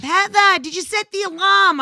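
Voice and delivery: put-on high, loud Boston-accented holler; shrill-pitched mock yell in a Boston accent; mom yelling upstairs. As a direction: imitate mom yelling up through the house. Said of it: "Robin's yelling up".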